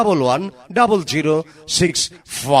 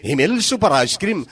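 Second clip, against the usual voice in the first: ad announcer; 5 semitones higher than usual. Acted. Loud mic ad promo.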